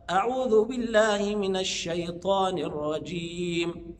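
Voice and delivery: slow recitation, airy spread ش in "الشيطان"; spreading sheen. Shown as a demonstration. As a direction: let air spread through the ش of "الشيطان". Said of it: "اسمها صفة التفشي أو الانتشار". (تلاوة متأنية بتفشي شين "الشيطان")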